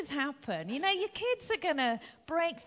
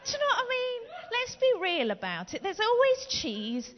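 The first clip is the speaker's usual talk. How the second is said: ordinary talk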